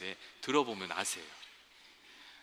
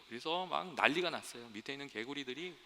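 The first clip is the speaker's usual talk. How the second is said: usual speaking voice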